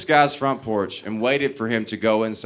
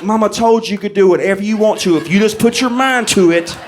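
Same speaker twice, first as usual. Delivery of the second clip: higher, louder proud boast; proud swagger; 8 semitones higher, 5 dB louder than usual. Retold or imitated. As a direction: crow it like a kid who just won. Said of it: "so proud of myself".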